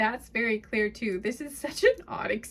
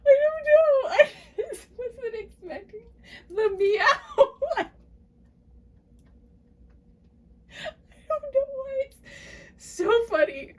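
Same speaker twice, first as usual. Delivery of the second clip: very high, wheezy laughing squeal; laughing hard; 11 semitones higher, 4 dB louder than usual. Real, not acted. Reader unable to explain the funny meow, helpless laughter. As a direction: barely get the words out for laughing. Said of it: "make me laugh so hard".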